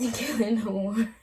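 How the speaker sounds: loud burst of laughter mid-sentence; can't hold the giggles back; laughing through the news. Real, not acted. Vlogger laughing while telling fans of breakup. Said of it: "the real reason why I'm laughing".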